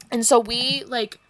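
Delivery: normal talking voice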